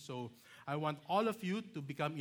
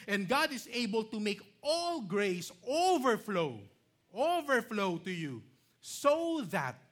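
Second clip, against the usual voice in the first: loud, high, forceful preaching voice; emphatic scripture reading; 7 semitones higher, 8 dB louder, slower than usual. Real, not acted. Preacher proclaiming God's promise to Abraham, loud and emphatic.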